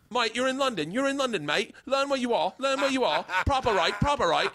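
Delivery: high, hammed-up mock Australian; mock a scolding in exaggerated Australian; Australian accent. London comics scolding the American, high mock Australian accent. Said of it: "I think I'm doing Australian".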